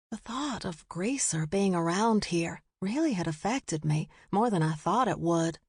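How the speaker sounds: ordinary delivery, nothing marked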